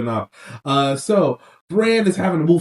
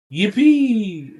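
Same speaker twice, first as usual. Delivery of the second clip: high, drawn-out fake hooray; mock cheer; 6 semitones higher than usual. Host cheering Bran becoming a hostage, mock-happy. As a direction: cheer with pretend glee.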